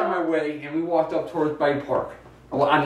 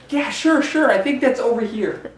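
helpful high voice; 6 semitones higher than usual. Retold or imitated. Retelling talking to old ladies.

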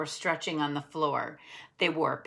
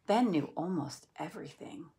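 ordinary delivery

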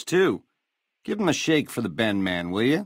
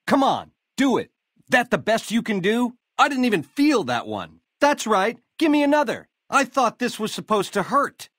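mocking taunt; 11 semitones higher than usual. Taunting the attacker while being hit.

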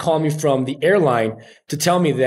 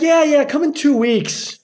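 casual director brushing him off, higher; mock director; 12 semitones higher, 3 dB louder than usual. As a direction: toss it off like a busy casting director. Director's breezy reply retold.

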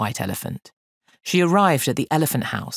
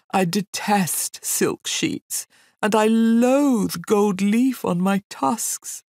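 higher, drawn-out, self-pitying lament; mournful elephant; 6 semitones higher, slower than usual. A narrator's reading. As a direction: bemoan your lot slowly, like a great put-upon beast. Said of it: "said Hamlet mournfully".

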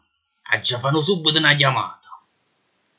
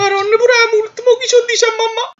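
Neapolitan clown voice; 4 dB louder than usual. Acted. Detainee panics over the fine.

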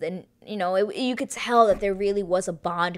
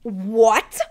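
shocked yell; 2 semitones higher, 5 dB louder than usual. Retold or imitated. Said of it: "I literally screamed".

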